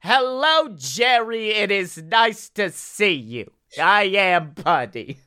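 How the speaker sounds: high, slow comedian doing a sitcom role; John Mulaney. Acted. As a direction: greet in a hammed-up, drawn-out comedian voice. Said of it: "John Mulaney as Putty from Seinfeld".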